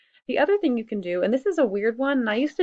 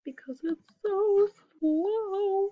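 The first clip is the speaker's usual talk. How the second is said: high, drawn-out whine of impatience; exasperated whine; 11 semitones higher, much slower than usual. Real, not acted. Teacher moaning to students about a slow computer, exasperated whine. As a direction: stretch it slowly, pitched way up.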